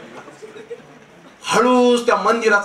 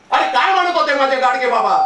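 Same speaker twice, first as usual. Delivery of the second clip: ordinary talk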